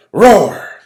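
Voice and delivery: high, loud roar; roaring storm. Acted. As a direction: roar like the wind. Storm sound effect in story.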